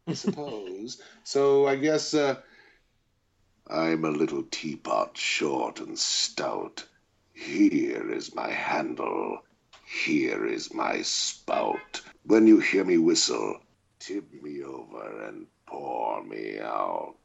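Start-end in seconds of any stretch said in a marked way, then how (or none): 3.7-5.2 very deep, menacing villain read of a nursery rhyme; sing-song rhyme turned into a deep threat; villainous voice. Shown as a demonstration. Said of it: "could you say something silly in it for us". 5.2-17.2 very deep and slow, menacing villain voice; slow theatrical villain menace. Shown as a demonstration. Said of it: "could you say something silly in it for us".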